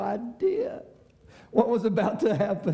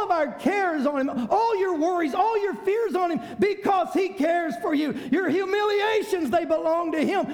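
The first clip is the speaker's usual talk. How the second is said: full-force preaching; 9 semitones higher than usual. Acted. Pastor urging congregation, impassioned preaching. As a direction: lift it up and preach.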